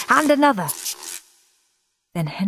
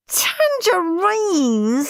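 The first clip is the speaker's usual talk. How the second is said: high slow wailing cry; screamed; 12 semitones higher, 3 dB louder, much slower than usual. Acted. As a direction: wail in outrage. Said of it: "screamed Stuck-up Steve".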